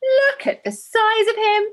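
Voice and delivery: high, slow, loud astonished shout; midwife's shout. Retold or imitated. Irish midwife shouting to the mother about the big baby, astonished. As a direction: call out the baby's size in amazement. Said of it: "the midwife shouted".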